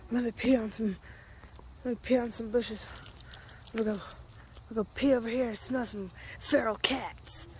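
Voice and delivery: lightly voiced dog, low and soft; voicing the dog. Owner lightly voicing her dog sniffing the bushes.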